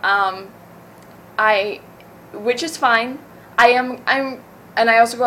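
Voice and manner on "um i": ordinary delivery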